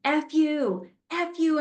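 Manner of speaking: hammed-up kid tantrum; retold kid swearing. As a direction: imitate a furious ten-year-old. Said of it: "swore at him".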